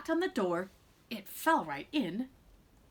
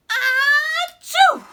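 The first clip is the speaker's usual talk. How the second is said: huge, high, loud hammed-up sneeze; giant sneeze; 15 dB louder than usual. Wolf sneezes the house down, huge mock sneeze. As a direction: let rip a massive comic sneeze.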